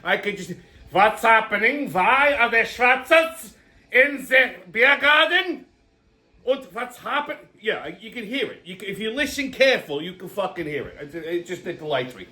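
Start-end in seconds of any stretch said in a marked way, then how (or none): 0.9-7.4 slow, hammed-up German accent; outraged mock-German, drawn out word by word; German accent, imitated Nazi. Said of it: "you can actually hear the Nazis".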